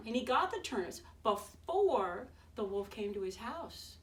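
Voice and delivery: ordinary delivery, nothing marked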